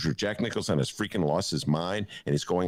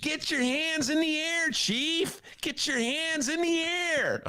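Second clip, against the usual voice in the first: high, slow, drawn-out impression voice; host doing Jack Nicholson on request; slower than usual. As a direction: do your best Nicholson, stretched out. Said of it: "Can we hear that impression".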